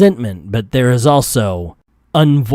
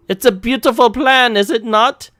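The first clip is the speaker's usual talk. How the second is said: a weak attempt at a German villain accent, pitched up; bad German accent; 9 semitones higher than usual. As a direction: try a German accent and fail. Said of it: "I can't do German accent".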